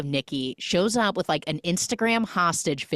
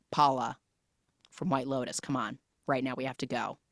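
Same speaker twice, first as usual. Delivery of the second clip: low-key, softer retold plea; muted urging; 3 semitones lower, 6 dB quieter than usual. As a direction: quietly urge her to leave, pausing after the name.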